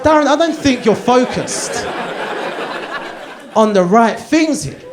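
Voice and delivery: loud, high, laughing through it; can barely get the wife's words out; laughing while quoting. Retold or imitated. Quoting his wife while cracking up, loud and laughing.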